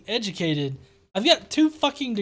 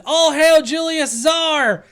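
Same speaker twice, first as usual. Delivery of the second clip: slow, loud royal hail, pitched up; mock proclamation; 6 semitones higher, 7 dB louder, slower than usual. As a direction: proclaim it like a herald. Reading comment in mock proclamation.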